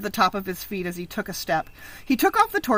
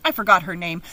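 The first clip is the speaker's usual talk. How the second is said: ordinary delivery, nothing marked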